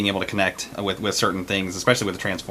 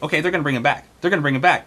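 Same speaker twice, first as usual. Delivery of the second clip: retold hopeful self-reassurance, pitched up; waiting expectantly; 7 semitones higher, faster than usual. Fan reassuring himself during the movie, expectant. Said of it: "I was just waiting, like".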